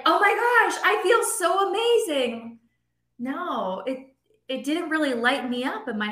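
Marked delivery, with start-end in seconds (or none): 0.0-2.6 mock gushing, much higher; imagined elation. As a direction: gush like a thrilled winner, tongue in cheek. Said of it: "I thought it was gonna be like".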